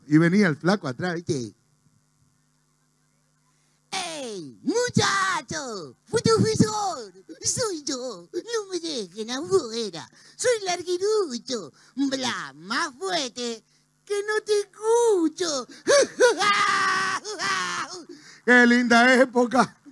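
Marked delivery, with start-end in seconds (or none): 3.9-11.7 voz chillona de larguirucho, muy aguda y estirada; high, drawn-out cartoon voice calling out. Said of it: "ahí se viene el larguirucho". 11.9-13.6 high, soft cartoon voice; cartoon character voice. Sigue la voz de Larguirucho. 14.1-15.7 very high, stretched cartoon cry; cartoon character voice. Sigue la voz de Larguirucho. 15.9-18.0 very high, squeaky laugh; long high cackle in a cartoon voice; cartoon character laugh. Risa del personaje Larguirucho.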